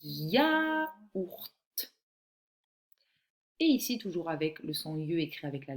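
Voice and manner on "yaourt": "yaourt" drawn out high, sound by sound; slow, higher-pitched dictated "yaourt"; slow dictation. Shown as a demonstration. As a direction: stretch "yaourt" so it can be written down. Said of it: "Je vais te lire".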